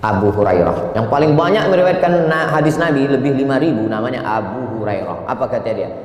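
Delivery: ordinary talk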